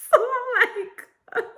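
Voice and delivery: laughing. Real, not acted. Laughing at friend's plan.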